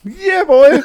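high whooping cheer; excited shout. Real, not acted. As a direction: whoop it out, thrilled. Cheering about curly fries.

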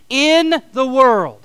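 slow, loud, every word leaned on; slow call and response. Shown as a demonstration. Congregation repeating after pastor, slow emphatic response. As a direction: drag it out with the congregation. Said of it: "Would you say that with me?".

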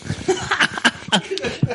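high laugh. Real, not acted. Laughing about naming Mike, high burst of laughter.

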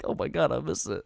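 ordinary talk, nothing marked

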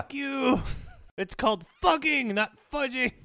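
high, loud mock yell at the viewers; hammed-up furious shout; mock angry shout. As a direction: yell it like an offended Austrian. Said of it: "Then they'd be mad".

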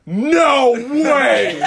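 high, loud mock disbelief; astonished crowd shout. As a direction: imitate a crowd yelling in amazement. Crowd's astonished reaction retold.

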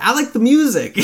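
high-pitched, jokey defensive quote; mock innocent excuse. Imitating a strip club excuse, high mock innocence. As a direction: pitch it way up, playfully.

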